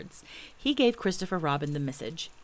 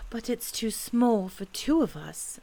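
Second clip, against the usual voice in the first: put-on higher, slow, forlorn boy; plaintive child voice; sad; 5 semitones higher, slower than usual. A narrator's reading. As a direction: sigh and say it in slow disappointment. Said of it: "said Christopher Robin sadly".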